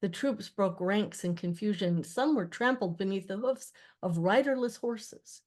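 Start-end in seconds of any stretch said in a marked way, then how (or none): none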